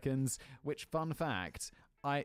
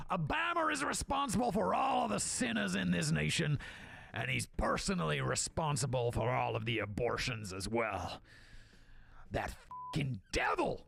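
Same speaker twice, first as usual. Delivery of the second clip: hammed-up preachy outrage; mock pundit rant. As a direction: rail like a fire-and-brimstone host. Continued Tucker parody.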